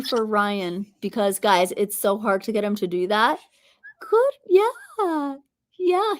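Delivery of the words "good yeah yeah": high-pitched cooing praise; pet praise. Real, not acted. Owner praising bird for whispering, high pet talk.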